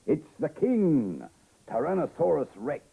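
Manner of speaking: high, loud, drawn-out announcement; dramatic reveal. A narrator's reading. Reader reveals the most feared dinosaur to the child, dramatic and loud. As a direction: announce it big, stretching it for effect.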